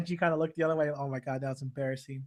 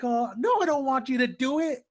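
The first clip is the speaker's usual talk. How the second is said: high, loud mock protest; alarmed refusal; 7 semitones higher, 5 dB louder than usual. Tenchi tells Washu not to touch him, alarmed refusal. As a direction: imitate someone frantically refusing.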